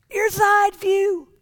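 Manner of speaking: put-on high, slow, strained old-man voice. Old man lying under the Ferrari, high, slow and strained. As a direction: squeeze it out like a frail old man.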